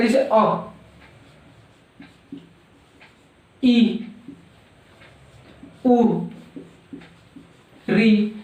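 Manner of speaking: अ, ई, उ, री, each said short and quick; short vowels said briefly. Shown as a demonstration. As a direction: clip each vowel short to show it takes little time. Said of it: "कम समय लग रहा है". (छोटे स्वर, हर एक को जल्दी और छोटा बोलना)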